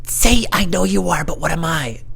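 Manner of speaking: high, slow mock-whispered coaching; imitation of mom prompting on the side; mom's aside prompt. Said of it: "off to the side whispering to me".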